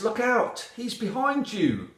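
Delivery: high-pitched alarm call; warning shout. A narrator's reading. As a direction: cry a warning as the owl. Owl warns the heroine in story.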